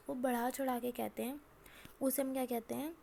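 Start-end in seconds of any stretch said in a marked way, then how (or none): none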